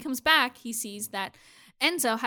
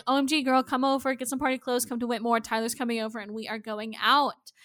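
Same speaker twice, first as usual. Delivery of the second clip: ordinary talk, nothing marked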